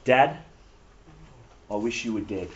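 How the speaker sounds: low, hushed delivery with a long pause; lightly acted, drop it low and soft; grave quoted insult. Voices the rebel's cruel words.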